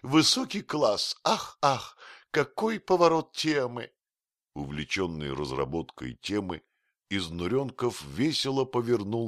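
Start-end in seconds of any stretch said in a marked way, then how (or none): 0.0-3.9 high, loud, gleeful exclaiming; exultant, gushing high voice; mirthful delight. A narrator's reading. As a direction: burst out in delighted admiration. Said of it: "заливаясь смехом, произнёс".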